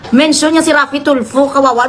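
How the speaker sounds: usual speaking voice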